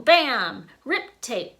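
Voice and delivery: ordinary delivery, nothing marked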